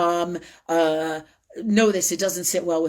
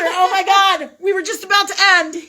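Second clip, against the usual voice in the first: higher and louder, real surprise; excited exclaim; 10 dB louder than usual. Real, not acted. Host surprised by a picture the guest found, real excited exclaim. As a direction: blurt it out, caught off guard.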